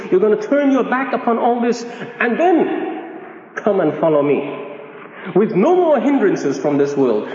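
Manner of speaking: sweeping slow delivery that drops on the closing words; emphatic preaching. Retold or imitated. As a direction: preach it as a solemn demand, stretching key words. Preacher voices Jesus demanding surrender.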